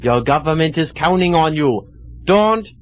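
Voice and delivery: low-pitched German commander putting someone under; German brainwasher. Acted. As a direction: give hypnotic orders in a mock German accent. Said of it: "Germans were master brainwashers".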